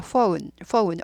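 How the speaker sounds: phone drawn into foh-oon; phone with f, then o sliding to u; English o-u glide. Shown as a demonstration. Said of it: "오우 발음인데".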